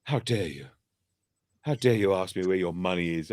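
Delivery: lightly put-on sultry woman's voice, mimicking the customer service lady; a hint of a sultry telephone voice, acting offended. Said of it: "you got a right telephone sex voice".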